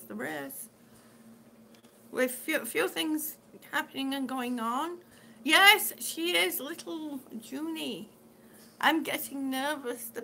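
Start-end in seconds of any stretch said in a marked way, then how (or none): none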